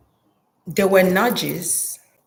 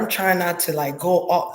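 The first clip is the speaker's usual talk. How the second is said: normal talking voice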